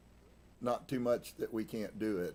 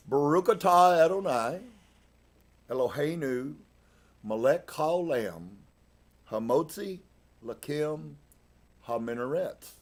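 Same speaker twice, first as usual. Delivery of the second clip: raised pitch, phrase-by-phrase prayer delivery; Hebrew blessing recitation; 5 semitones higher, 6 dB louder than usual. Acted. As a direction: recite a blessing aloud, pausing after each phrase. Recites Hebrew bread blessing aloud.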